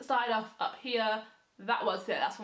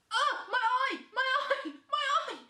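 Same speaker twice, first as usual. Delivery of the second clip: pained cry; 12 semitones higher, 4 dB louder than usual. Real, not acted. Avocado in her eye.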